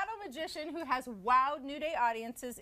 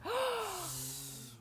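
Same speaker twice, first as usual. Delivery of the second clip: gasp; 11 semitones higher, 5 dB quieter than usual. Real, not acted. Reacting to floating water trick.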